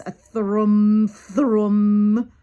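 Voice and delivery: loud, droning thrum; held, resonant hum on one note; imitated humming engine noise. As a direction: hum it out like a hovering spaceship. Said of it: "It was a noise like this".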